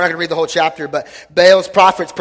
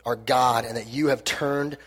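scripture reading; 3 semitones lower than usual. Acted. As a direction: read it as a reverent prayer. Reads scripture prayer aloud.

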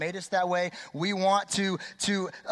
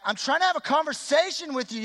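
exasperated complaint; 8 semitones higher than usual. Retold or imitated. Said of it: "then you're like".

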